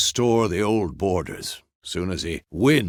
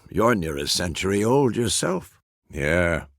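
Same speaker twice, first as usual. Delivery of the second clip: ordinary delivery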